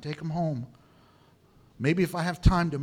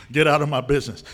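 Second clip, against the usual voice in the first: laughing retort; 4 semitones lower, 6 dB louder, faster than usual. Acted. Playful retort to imagined mocker.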